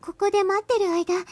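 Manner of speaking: put-on high, relieved young girl. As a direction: sigh out relief in a girlish voice. Girl relieved after waiting.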